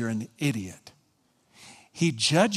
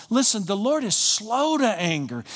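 ordinary talk, nothing marked

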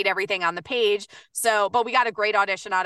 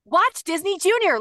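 mock upbeat promo; 8 semitones higher than usual. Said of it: "I was like".

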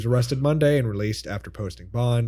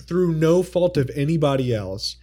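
usual speaking voice

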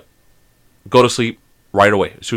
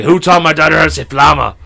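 louder, higher indignant dad; mock angry demand; 4 semitones higher, 5 dB louder than usual. Dad storms into school over a word, mock outrage. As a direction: play an outraged parent confronting the school. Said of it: "go in there and start like".

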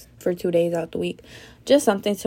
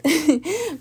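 short laugh; 8 semitones higher than usual. Real, not acted. Laughs at own joke.

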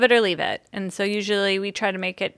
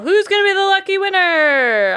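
rising hype, louder toward the end; hyped giveaway announcement; 9 semitones higher, 5 dB louder than usual. Acted. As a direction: build suspense like a game host. Spinning giveaway wheel live.

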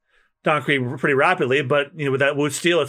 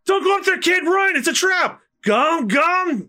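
frantic warning shout; 12 semitones higher than usual. Acted. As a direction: warn the kid in a panic. Bystander warning Luffy.

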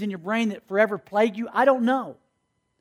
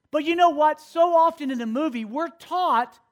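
ordinary delivery

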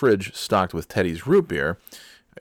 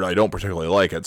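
usual speaking voice

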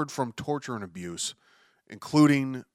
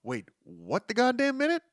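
higher, incredulous double take; high-pitched mock disbelief; mock outrage; 11 semitones higher than usual. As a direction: play a stunned viewer who can't believe it. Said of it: "sit there and go".

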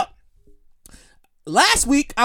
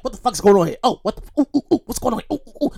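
nervous muttering; 4 semitones lower, faster than usual. Retold or imitated. Waiting on his license, fretting low under his breath. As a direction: fret under your breath, ooh ooh. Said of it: "I, I'm nervous".

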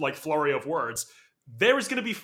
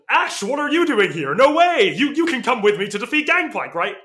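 excited; 8 semitones higher, 8 dB louder than usual. Retold or imitated. Acting out an excited game character.

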